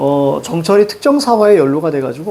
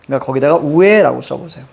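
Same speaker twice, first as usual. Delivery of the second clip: ordinary talk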